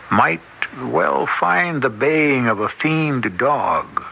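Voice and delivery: usual speaking voice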